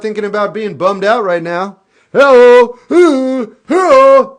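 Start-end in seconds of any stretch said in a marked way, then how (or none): 2.1-4.4 high, loud sing-song greeting; silly shouted hello; goofy loud hellos. Acted. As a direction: call out like a clown. Goofing around to lift mood.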